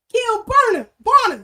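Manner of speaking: loud, high call-out that catches its own slip; loud shout-out. Real, not acted. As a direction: shout the name across the room. Host shouts out a viewer's name.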